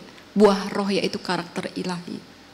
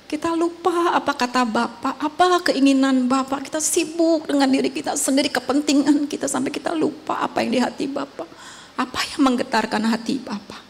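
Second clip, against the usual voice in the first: fervent preaching; 5 semitones higher than usual. Acted. Preaching at rising force.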